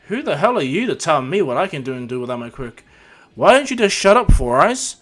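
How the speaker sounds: loud, indignant comeback in a quarrel; angry retort. Acted. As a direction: snap loudly at a nosy rival.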